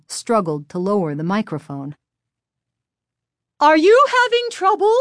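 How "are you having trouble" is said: high, loud call from offstage. A narrator's reading. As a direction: call across the stage in a high voice. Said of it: "called from the side of the stage".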